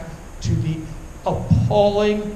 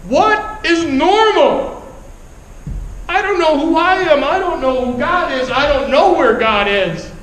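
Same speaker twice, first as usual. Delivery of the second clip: anguished; 6 semitones higher than usual. Retold or imitated. Breakdown over lost friends and identity.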